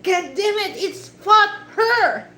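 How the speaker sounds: loud, high mock outburst; hammed-up angry insistence, last word drawn out; upset dad. Upset dad insisting to his daughter, mock angry outburst. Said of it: "I'm so upset".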